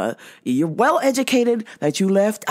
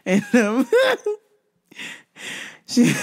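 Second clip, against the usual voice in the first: ordinary talk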